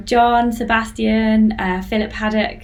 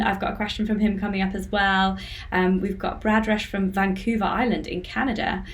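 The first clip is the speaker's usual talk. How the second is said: ordinary talk, nothing marked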